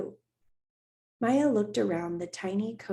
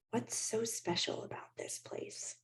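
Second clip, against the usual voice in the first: soft whispered question to a toy horse; 2 semitones higher, 10 dB quieter than usual. A narrator's reading. Maya alone in her teepee, whispering. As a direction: breathe it to the horse. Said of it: "she whispered".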